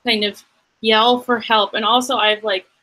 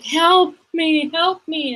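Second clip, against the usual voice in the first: damsel cry for help; 8 semitones higher, slower than usual. Acted. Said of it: "set my pant leg on fire or something and be like".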